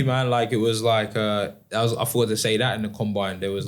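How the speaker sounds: ordinary talk, nothing marked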